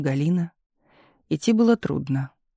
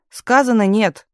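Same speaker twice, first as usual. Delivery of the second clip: higher, louder angry refusal; sudden yell; 5 semitones higher, 6 dB louder, slower than usual. A narrator's reading. Telegraph operator suddenly yelling a refusal at Galina. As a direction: snap it out in a raised voice. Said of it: "вдруг заорала телеграфистка".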